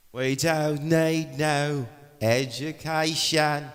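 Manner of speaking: put-on slow, stretched Cockney accent, pitched high; sing-song Cockney schoolboy line, drawn out slowly. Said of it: "It's my Cockney, Cockney accent".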